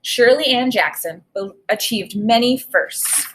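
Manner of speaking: normal talking voice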